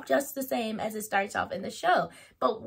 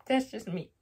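ordinary delivery

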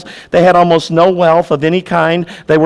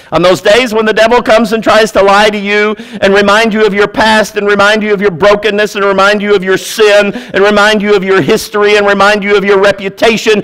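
building preach; 4 semitones higher, 3 dB louder than usual. Real, not acted. Preaching builds against the devil.

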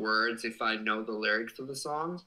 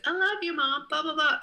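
very high, loud sing-song mock reading; reading gift message; 6 dB louder than usual. Streamer reading out the card's message, high mock-sweet voice. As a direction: squeal it out, hammed-up. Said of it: "And it says".

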